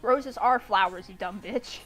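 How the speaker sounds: normal talking voice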